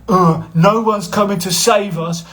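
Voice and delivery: mock the whinger, whining; moany whinger impression. Mocking a commenter in a whiny voice. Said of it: "you get the odd twat that'll come in and go".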